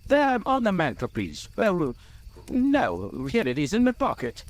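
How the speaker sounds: high-pitched, flustered little hobbit voice. A narrator's reading. Bilbo finds the ring in his pocket, flustered. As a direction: correct yourself in a high, fussy voice.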